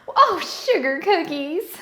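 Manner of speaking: slow, loud southern drawl on "Oh, gingerbread"; mock-curse in a Southern accent; Southern belle cursing with cookie names. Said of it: "getting a southern accent doing this".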